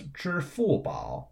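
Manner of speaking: measured teacher's 支付宝, level, falling, dipping; first, fourth, third tones. Shown as a demonstration. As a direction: hold 支, drop 付, dip on 宝. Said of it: "B A O in the third tone".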